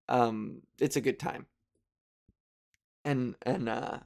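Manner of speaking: ordinary talk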